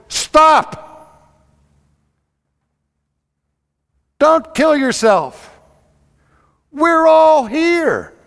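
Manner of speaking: high, loud, slow shout of stop; loud cry. Acted. Paul shouting to the jailer to stop. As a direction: call out to stop him, slowly. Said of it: "crying in a loud voice".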